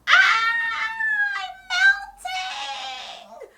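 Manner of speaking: very high, loud shriek of a wail; wailing witch. A narrator's reading. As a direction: wail as she shrinks away. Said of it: "she wailed".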